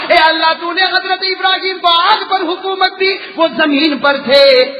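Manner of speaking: loud, rhythmic pleading to Allah; full-voiced preaching. Preacher voicing the Prophet's question to Allah, impassioned preaching. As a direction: build each refrain for the crowd.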